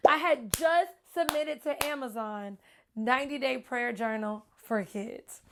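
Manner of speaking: ordinary talk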